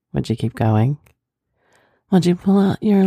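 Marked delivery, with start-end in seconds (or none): none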